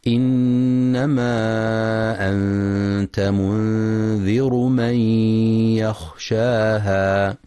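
slow, measured tajweed chant; Quran recitation. Acted. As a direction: recite it slowly and melodically.

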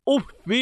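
أف said "uffi", short i after doubled f; kasra ending. Shown as a demonstration. Teacher contrasting forms for students, أف as "uffi". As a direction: end أف on "-fi". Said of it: "جعله بمنزلة مد يدك".

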